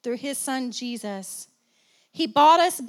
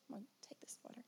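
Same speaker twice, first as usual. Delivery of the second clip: whispered aside; 3 semitones lower, 29 dB quieter, much faster than usual. Real, not acted. Aside while taking water.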